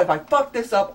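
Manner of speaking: usual speaking voice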